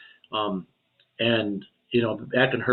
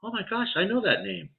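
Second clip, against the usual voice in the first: surprise; 9 semitones higher than usual. Retold or imitated. Realising sister's name.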